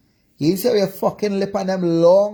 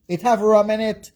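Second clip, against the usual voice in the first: normal talking voice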